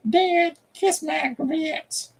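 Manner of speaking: very high, drawn-out little pet voice; put-on squeaky voice, stretched out; speaking as Corbin. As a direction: squeak it out slowly in a tiny pleading voice. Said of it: "Corbin saying".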